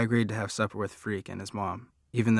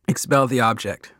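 put-on loud, urgent kid's order; shouted command; 3 semitones higher, 6 dB louder than usual. A narrator's reading. Freak shouting orders at his choking friend. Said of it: "Freak shouts out".

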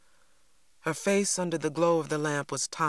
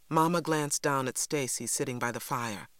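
ordinary delivery

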